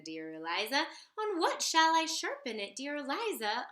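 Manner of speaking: ordinary delivery, nothing marked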